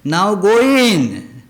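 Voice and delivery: firm acted command, drawn out. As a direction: order them inside, stretching each word. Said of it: "Their command is".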